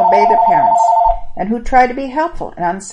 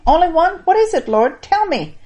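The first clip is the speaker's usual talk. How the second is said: higher, eager pleading; young man begging Jesus, higher and eager; 11 semitones higher, 5 dB louder than usual. Acted. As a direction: beg to know, eagerly rising. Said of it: "I can hear the young man saying".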